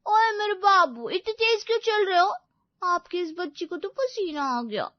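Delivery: put-on high, tearful baby whine; whiny doting girlfriend. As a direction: complain like a tired little child. Voices Payal whining on the walk.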